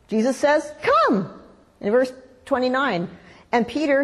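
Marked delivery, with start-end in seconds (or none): 0.8-1.4 high-pitched, falling one-word call. Acted. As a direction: call someone over from afar. Voicing Jesus calling Peter.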